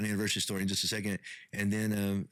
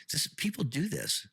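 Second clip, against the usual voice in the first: higher, slowed retold grumble; mildly exasperated, pitched up and drawn out; mild retold annoyance; 7 semitones higher than usual. Husband grumbling to his wife by the pool, mildly annoyed. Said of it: "I got really angry".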